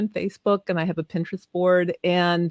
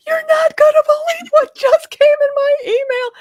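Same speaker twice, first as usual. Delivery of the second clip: squealing the news, much higher and louder; thrilled cry; 8 dB louder than usual. Retold or imitated. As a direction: shriek it out, almost crying with joy. Retells calling out her big news.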